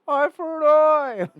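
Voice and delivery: high, very loud drawn-out yell; imitated yell. Retelling someone's call for a stipulation, yelled high and slow. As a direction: yell it like a heckler, stretched out. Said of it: "and then someone yells".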